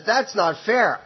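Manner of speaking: high, indignant outburst; ranting. Retold or imitated. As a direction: protest it as unfair, pitch jumping up. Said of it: "she's ranting on and on".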